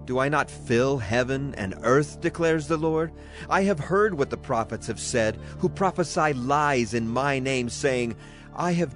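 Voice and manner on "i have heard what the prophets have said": usual speaking voice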